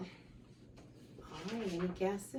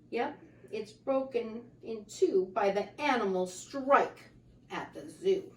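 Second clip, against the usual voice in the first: ordinary delivery, nothing marked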